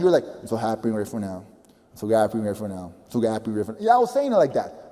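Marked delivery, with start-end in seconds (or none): 0.4-3.8 low, fast, lifeless mumble; bored half-hearted recital; no energy. Shown as a demonstration. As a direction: rattle it off like a chore. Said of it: "you're not repeating with energy".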